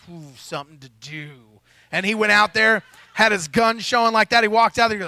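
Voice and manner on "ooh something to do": low, soft, gleeful big guard; eager guard. Retold or imitated. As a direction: savor the chance for action, quietly. Security guard Tank voiced.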